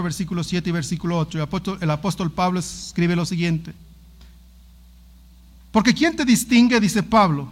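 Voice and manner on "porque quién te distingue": loud, solemn Bible reading; scripture reading. Acted. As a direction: proclaim the verse to the congregation. Lectura bíblica en voz alta. (lectura solemne y fuerte de la Biblia)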